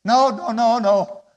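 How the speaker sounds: loud, high, insistent no; loud refusal. Real, not acted. Pastor urging the church never to quit, loud refusal.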